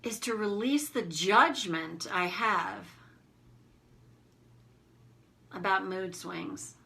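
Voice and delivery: slow, measured guide with long pauses between phrases; guided self-reflection. Acted. Coach leading listeners to release self-judgment, slow guided reflection.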